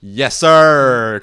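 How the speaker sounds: loud victorious yell; triumphant shout. Retold or imitated. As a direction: shout it out like a win. Retold triumphant exclamation.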